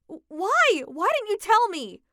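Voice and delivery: loud, high stunned demand; shocked outcry. Acted. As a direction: cry out in disbelief. Daughter learns of cancer.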